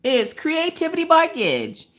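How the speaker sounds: high, drawn-out shout-out; excited announcement. Real, not acted. Answering viewers about her nail artist, high excited announcement.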